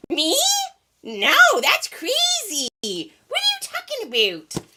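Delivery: high, exaggerated feigned innocence; hammed-up high-pitched denial; mock disbelief. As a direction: squeal it as if wrongly accused, stretching the words. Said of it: "and I was like".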